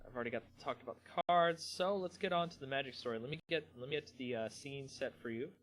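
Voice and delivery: normal talking voice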